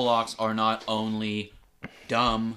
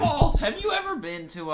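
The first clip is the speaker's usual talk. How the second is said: high, loud protest; loud objection; 4 dB louder than usual. Real, not acted. Cohost shouting to refute his partner, high loud protest. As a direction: shout him down.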